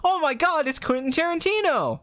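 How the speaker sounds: high-pitched excited fan; mock starstruck. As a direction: squeal it like a hammed-up fan. Acted fan spotting the director.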